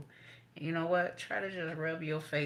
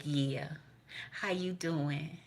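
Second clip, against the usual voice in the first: slow, softer mock greeting; drawn-out hushed hello; 6 dB quieter, much slower than usual. Greeting grandmama, slow and soft.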